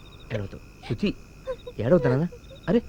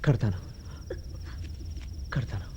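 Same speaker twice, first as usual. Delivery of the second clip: low, soft; 13 semitones lower, 15 dB quieter than usual. Acted. Reluctant low reply.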